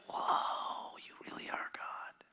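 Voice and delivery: breathless whispered wonder; awed whisper. Acted. Disciples stunned as Jesus calms the storm, awed whisper. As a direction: whisper it in awe.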